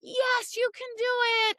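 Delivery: mock an overeager dog owner; high imitated pep talk; cheerleading the dog. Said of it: "cheerleading the dog".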